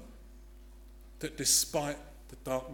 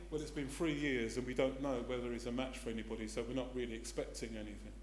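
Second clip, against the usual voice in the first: hushed and quick, much softer; very quietly; 4 semitones lower, 7 dB quieter, faster than usual. Retold or imitated. Relative quietly telling doctors expect nothing, softer. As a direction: say it low and fast, almost under breath. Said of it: "very quietly".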